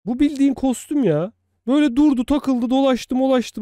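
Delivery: high, loud amazed rant; excited. Real, not acted. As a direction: marvel at it, voice climbing. Amazed at neighbor's costume. (heyecanlı ve şaşkın)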